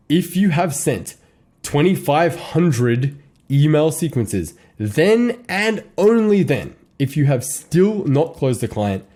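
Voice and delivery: each clause laid out slowly; slow, weighty pacing; slow emphatic advice. Real, not acted. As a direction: lean on "then and only then". Key advice said deliberately.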